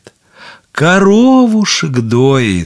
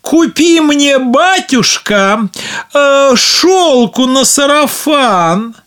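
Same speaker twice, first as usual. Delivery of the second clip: put-on higher, coaxing girl's voice; pleading daughter; 11 semitones higher than usual. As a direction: wheedle it like a spoiled daughter. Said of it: "Одна дочь просит".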